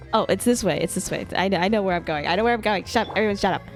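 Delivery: ordinary delivery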